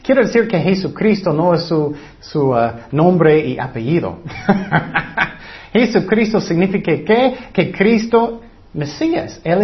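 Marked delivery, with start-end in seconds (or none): 4.2-5.3 short chuckle after a joke; light amused laughter. Real, not acted. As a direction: chuckle briefly at your own remark. Laughs after his name joke.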